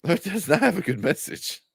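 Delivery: high, every word pressed, have stressed; insistent denial. Real, not acted. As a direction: dig in and insist. Insisting against critics.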